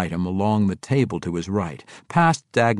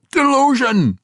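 high, loud dwarf cry; indignant shout; insistent outburst; 9 dB louder than usual. A narrator's reading. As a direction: shout it down in outrage. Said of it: "Ragged Dane insisted".

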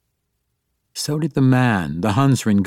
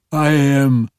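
higher, gurgling demon voice; gloopy bubbling slime voice; 4 semitones higher, 3 dB louder than usual. A narrator's reading. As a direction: gurgle like a bubbling mud puddle. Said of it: "in a gurgling voice".

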